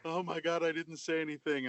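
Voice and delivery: sudden panicked outburst, then a frightened hush. Retold or imitated. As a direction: blurt it out in a panic, then drop to a scared murmur. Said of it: "I immediately went into panic mode".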